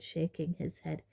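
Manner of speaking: ordinary delivery, nothing marked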